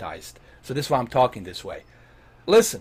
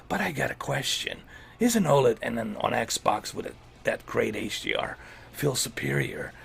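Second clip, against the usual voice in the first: a lightly voiced, hushed, lower action-hero growl; Solid Snake voice; 3 semitones lower, 5 dB quieter than usual. Reading a viewer's question as a hushed soldier hero. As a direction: read the viewer's question like a tired covert soldier, half-hearted. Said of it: "in a Solid Snake voice".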